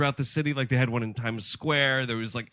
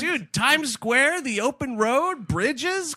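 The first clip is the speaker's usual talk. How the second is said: slow, high, gushing awe; hammed-up wonder; mock awe; 12 semitones higher, 3 dB louder, much slower than usual. Mock-rhapsodic praise of traffic cams.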